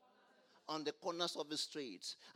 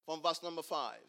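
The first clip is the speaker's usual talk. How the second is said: ordinary talk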